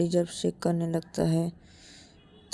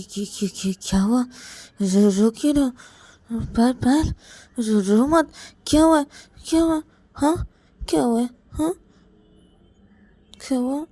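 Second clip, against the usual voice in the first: worried; 6 semitones higher, 6 dB louder than usual. Acted. Ananya alarmed seeing Payal cry.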